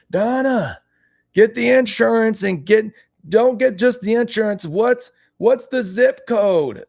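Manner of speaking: put-on high, loud, drawn-out old man; older man voice. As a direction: holler across the house as an old man. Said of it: "my older man accent".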